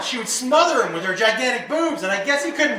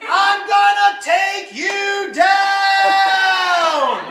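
high, long-drawn battle yell; top-of-lungs scream; 8 semitones higher, much slower than usual. Retold or imitated. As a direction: stretch each word into a hammed-up war cry. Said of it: "screams at the top of his lungs".

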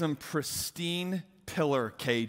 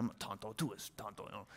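much softer, low mock grumble; mumbling under breath; 7 semitones lower, 12 dB quieter, faster than usual. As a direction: grumble it to yourself so no one hears. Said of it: "I started mumbling under my breath".

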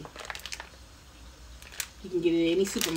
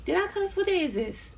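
high, playful gasp of guilt; mock surprise; 11 semitones higher than usual. Real, not acted. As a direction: pretend you just caught yourself. Mock alarm at own cussing.

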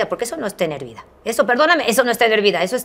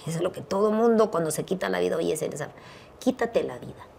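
ordinary delivery

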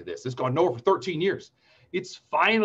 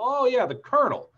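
sudden recognition; 10 semitones higher, 4 dB louder than usual. Retold or imitated. Dad realising who son means.

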